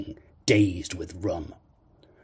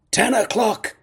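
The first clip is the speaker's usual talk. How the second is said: high, loud exclamation; excited cry; 13 semitones higher, 6 dB louder than usual. A narrator's reading. Captain reading what is in his palm, excited cry. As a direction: cry it out in alarm. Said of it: "he cried".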